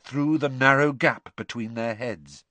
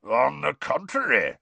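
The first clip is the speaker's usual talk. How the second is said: growled; 3 semitones higher than usual. A narrator's reading. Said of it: "growled Moody".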